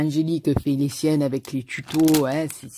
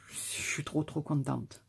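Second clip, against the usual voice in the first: hushed and breathless, almost no voice; moved whisper; 10 dB quieter than usual. Real, not acted. Touched by a viewer's handmade card, she whispers softly.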